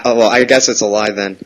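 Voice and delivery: ordinary talk, nothing marked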